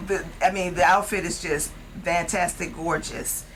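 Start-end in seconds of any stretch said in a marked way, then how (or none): none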